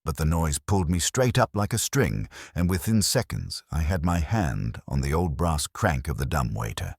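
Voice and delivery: ordinary delivery, nothing marked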